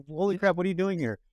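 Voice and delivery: high-pitched amazed disbelief; surprised, pitch jumping up; retold astonishment. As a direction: act out being taken aback. Retold amazement at meeting her.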